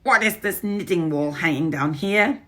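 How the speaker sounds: a witch poking at something odd; prying witch; suspicious witch. Acted. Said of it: "I heard her saying".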